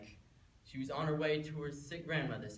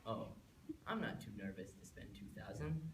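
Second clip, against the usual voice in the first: low, soft reply with a slight grin; sly slight grin; 6 semitones lower, 6 dB quieter than usual. Acted. Red agrees to take the wolf's money, slyly grinning. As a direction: let a small grin into a lowered voice. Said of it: "said with a slight grin".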